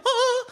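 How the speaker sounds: a sudden squeal of mock panic, high and loud; high mock shriek. Comedian mocking friends turning thirty, mock panic shriek.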